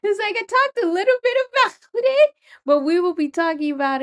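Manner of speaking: high, quick, laugh-choked voice; laughing through pain. Real, not acted. Host laughs high and fast about tragic backstory. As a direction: squeak it out through a pained laugh. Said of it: "Laughing through the pain".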